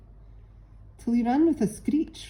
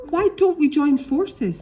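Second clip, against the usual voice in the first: eager; 10 semitones higher, 7 dB louder than usual. A narrator's reading. Pegleg proposes teaming up.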